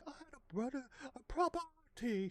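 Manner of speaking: hammed-up posh British voice; posh British accent. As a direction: put on a proper English lady.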